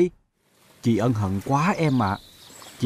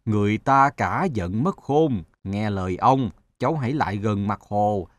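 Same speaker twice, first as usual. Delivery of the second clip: much lower, slow grandfather voice; wise old sun; 4 semitones lower, slower than usual. Acted. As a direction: explain gently, like an elder. Sun counsels.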